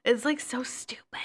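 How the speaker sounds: breathy whisper, slowed; hushed whisper; whispered aside. Real, not acted. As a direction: whisper it like a secret gripe. Annoyed aside about coworker.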